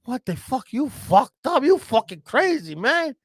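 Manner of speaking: high Spanish-accented mock scolding; outraged Spanish neighbour. Neighbour confronting the man who broke his wall, high incredulous scolding. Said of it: "He goes".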